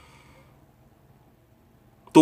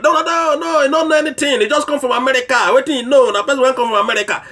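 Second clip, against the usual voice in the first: much higher scoffing voice of doubters; mock dismissal; 9 semitones higher, faster than usual. Imitating citizens dismissing Kanu, in a high scoffing voice. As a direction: parody people brushing off the candidate. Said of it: "so what I tell una say".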